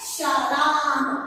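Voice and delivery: राम drawn out as if calling a distant friend; long calling. Shown as a demonstration. Teacher calling राम slowly to show three-times length. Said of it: "ज्यादा वक्त समय लेकर उसको बुलाते हैं".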